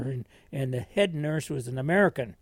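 usual speaking voice

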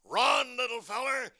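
high, urgent cartoon shout; Chopper. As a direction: call out to the little one in danger. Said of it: "Chopper says".

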